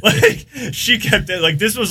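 high, loud words pushed through laughter; laughing. Real, not acted. Laughing over months of delay.

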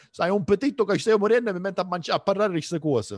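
fast loud Italian. Real, not acted. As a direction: rattle it off in Italian. Hungry, switches to Italian.

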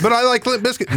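high coaxing plea; lightly mocked high pleading; pleading husband. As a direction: try to talk her into it. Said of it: "And I'd be like".

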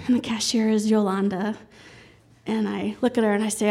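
ordinary talk

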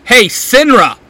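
high, loud call; a loud high challenge; shout. A narrator's reading. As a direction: grab everyone's attention. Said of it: "Simon shouts".

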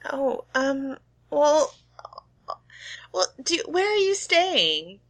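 high, gentle shop clerk; sweet small-town cashier; shopkeeper voice. Acted. GM voicing Shirley.

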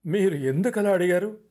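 high, shaky nervous question; rattled and scared; trembling voice. A narrator's reading. Ratnam nervously asking Vikram why he asked. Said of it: "వణుకు స్వరంతో".

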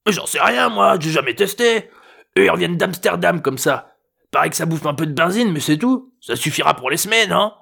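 high, offhand grandfather voice; careless old man. A narrator's reading. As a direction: shrug it off, old-timer style.

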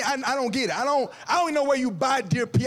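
ordinary talk

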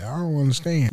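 normal talking voice

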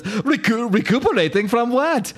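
a pantomime German henchman asking; German accent. Retold or imitated. The other henchman asks in a hammed-up German accent. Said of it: "now Germans from now on".

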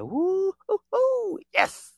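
whooping way up high; hammed-up hoots of delight; mock energy rush. Mocking energy-drainers, whooping high with delight. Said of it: "it's just like".

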